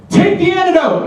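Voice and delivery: very high, shouted command; crazed yell. Retold or imitated. Meth woman to the comic, high crazed shout. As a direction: yell it like a madwoman. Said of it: "she yells".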